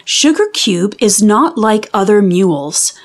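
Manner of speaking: ordinary delivery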